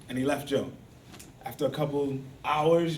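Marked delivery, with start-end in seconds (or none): none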